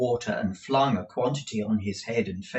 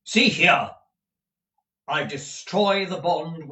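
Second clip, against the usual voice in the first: loud declaration; 6 semitones higher, 6 dB louder than usual. Acted. Ralph declares as he destroys bond.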